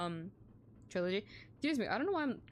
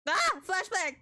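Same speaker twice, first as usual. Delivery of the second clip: sudden loud yelp, pitched up; startled shout; 6 semitones higher, 6 dB louder, slower than usual. Real, not acted. Streamer startled by a flashbang on screen, loud yelp. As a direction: jump at the flash and yell it.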